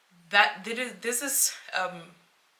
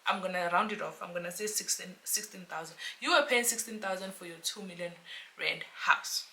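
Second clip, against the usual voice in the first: ordinary talk, nothing marked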